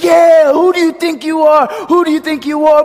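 high, loud imitation of a triumphant bowler; hammed-up victory shout; mock angry-joyful outburst. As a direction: yell it like an overexcited champion. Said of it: "he looked angry, sad".

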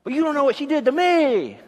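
a hint of an indignant man; pretend heated retort. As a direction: argue back in a mock huff.

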